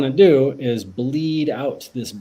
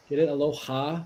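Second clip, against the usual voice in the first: ordinary delivery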